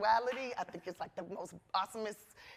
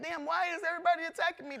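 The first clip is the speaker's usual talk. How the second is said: exasperated complaint; 8 semitones higher, 4 dB louder, faster than usual. Retold or imitated. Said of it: "Sometimes you feel like".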